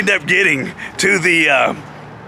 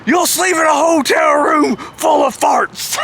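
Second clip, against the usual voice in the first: high, slow, hammed-up indignation; mock angry threat; 11 semitones higher, slower than usual. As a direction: imitate an outraged boss passing sentence. Jeff's angry threat retold.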